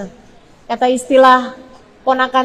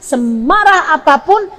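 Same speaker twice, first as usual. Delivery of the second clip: ordinary delivery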